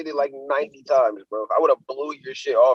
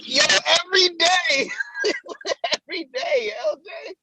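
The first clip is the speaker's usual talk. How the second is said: very high-pitched laughing outburst. Real, not acted. Co-host admits wanting to punch his friend, cracking up. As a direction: squeal it out through helpless laughter. Answers in hilarity whether he wanted it.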